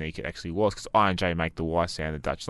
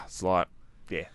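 ordinary talk, nothing marked

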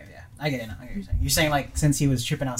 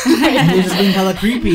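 laughing; 5 semitones higher, 6 dB louder than usual. Real, not acted. Laughing while describing creepy guy.

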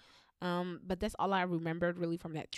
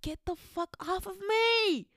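higher, slow, put-on exasperation; annoyed kid; 12 semitones higher, slower than usual. Child squirming from auntie's hug, high slow exasperation. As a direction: push the clingy auntie away. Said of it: "you know that feeling of".

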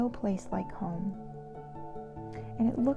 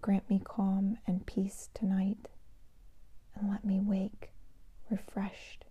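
sleep meditation whisper; 5 dB quieter than usual. Shown as a demonstration. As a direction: whisper them gently toward sleep. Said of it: "I'd like to share a meditation with you today".

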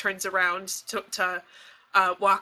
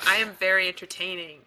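usual speaking voice

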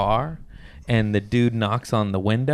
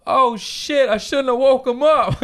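high, loud guilty realization; high mock dismay; 5 dB louder than usual. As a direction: blurt it in high alarm. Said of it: "he goes".

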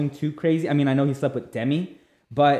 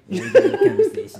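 high, fast squeaky mock Joe, laughing; awful Joe impression; 5 dB louder, much faster than usual. Podcaster mocks Joe agreeing to talk, squeaky and laughing. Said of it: "that's an awful Joe".

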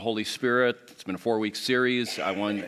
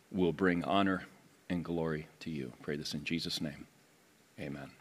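prayer; 4 semitones lower than usual. Acted. Leads a prayer.

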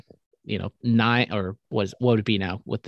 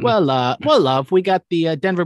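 louder, higher fake British patter; badly faked British accent; mock British accent; 5 semitones higher, 6 dB louder than usual. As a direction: put on a cheeky British accent. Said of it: "terrible British accent".

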